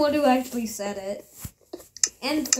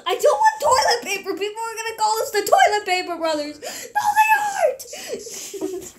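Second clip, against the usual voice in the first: laughing protest; 9 semitones higher than usual. Real, not acted. Boy objects to being a Toilet Paper Brother, squealing protest.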